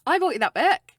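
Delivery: high, loud delighted exclamation; excited, pitched right up; excited recognition. Real, not acted. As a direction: burst in, thrilled to claim it.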